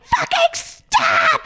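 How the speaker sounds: very high, drawn-out scream; screaming at drivers. Retold or imitated. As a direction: reenact screaming at traffic to halt. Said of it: "screaming at people to stop".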